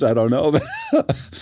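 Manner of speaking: ordinary delivery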